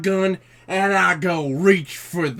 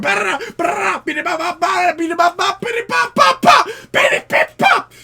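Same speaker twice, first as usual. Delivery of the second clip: shouted high gunshot sounds; gunfire noises; 7 dB louder than usual. Acted. Cowboy mowing down Martians, shouting gunfire noises. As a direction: blast them with your mouth like guns.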